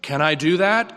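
ordinary talk

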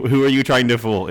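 ordinary delivery

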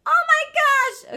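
high, loud, drawn-out cry; real burst of exasperation, stretched out; exasperated outcry. Real, not acted. Speaker keeps knocking into things mid-story, exasperated outcry.

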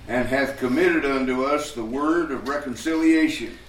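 measured scripture recital; scripture reading. Acted. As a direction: read it out like a pulpit lesson. Reads scripture aloud.

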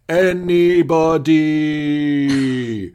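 long, drawn-out shout into emptiness; mock lonely holler; calling out. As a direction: call out high and loud, stretching every syllable. Acting out Riker calling out alone.

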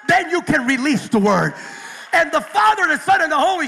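loud, building preaching; full-force preaching. Acted. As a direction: drive it home to the congregation.

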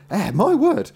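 high, loud astonished innkeeper; amazed exclamation. A narrator's reading. Host seeing gold pieces fall, amazed.

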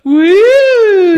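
very loud, high, long falsetto whoop; imitated taunting whoop. Friend recreating the taunt at driver, long loud whoop. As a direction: belt out a sustained "woo". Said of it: "the impression you gave me at the time".

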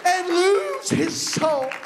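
slow, hoarse, straining to preach; strained hoarse voice. Real, not acted. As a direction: voice cracking from preaching. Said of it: "I'm losing my voice".

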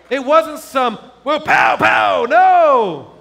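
loud, high-pitched triumphant preaching; preaching at full force. Real, not acted. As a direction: proclaim the miracle to the whole congregation. Triumphant preaching at the story's climax.

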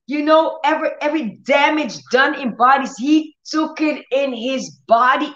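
lifted preaching voice; fervent preaching. Acted. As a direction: press the crowd to grasp it. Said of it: "preaching at full force".

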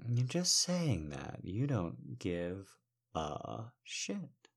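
slow, soft, teasing murmur with long pauses; seductive erotic audio. Acted. Erotic audio piece performed.

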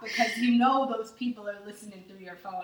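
loud, high, laughing imitation of a warning mom; mock scolding. A mom warning about listeners, mimicked loudly in mock scolding.

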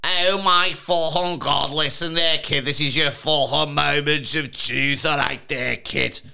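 hammed-up Scouse, starting high, loud and slow; terrible Scouse accent. Mimicking the game show host for co-host, terrible Scouse accent. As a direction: do a badly overdone Scouse presenter. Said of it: "That is the worst Scouse accent I've heard".

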